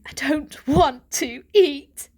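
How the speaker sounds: put-on desperate young cat pleading. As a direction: plead to be believed. Varjak pleading.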